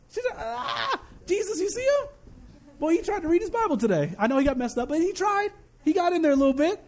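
high, excited bragging voice; proud boasting daddy. Acted. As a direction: brag like a new dad showing off his kid. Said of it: "boasting about you right now".

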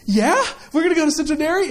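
mimicked childlike delight; wide-eyed excited child. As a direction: imitate a daughter lighting up at a treat. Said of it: "eyes get as big as saucers".

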